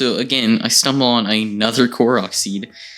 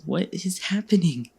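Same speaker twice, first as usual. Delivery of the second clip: high, softer cry of bewilderment, acted out; exasperated disbelief; 9 semitones higher, 5 dB quieter than usual. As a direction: act baffled and at your wit's end, pitched up without volume. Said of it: "I'm losing my mind".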